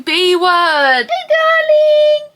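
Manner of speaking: high, slow squealed pet voice. Real, not acted. Greeting her dog in loud squeaky baby talk. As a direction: coo loudly at the dog.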